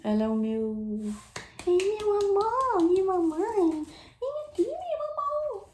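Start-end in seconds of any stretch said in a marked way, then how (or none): 1.7-5.7 much higher, slow cooing to a little dog. Real, not acted. Baby talk to her puppy.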